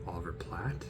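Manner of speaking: whispered question; whispered guess. Real, not acted. Host recalling the actor, whispered. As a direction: whisper the name as it comes. Remembering the name.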